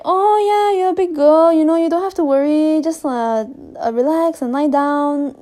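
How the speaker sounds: high, loud, sugary nurse voice; imitating the nurse. Nurse soothing a nervous young patient, high and loud. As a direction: coo at her like a nurse soothing a scared patient. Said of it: "the nurse was like".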